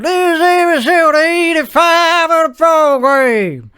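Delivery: high, drawn-out episode intro; show announcer. Acted. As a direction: announce the show with stretched words. Opens the show.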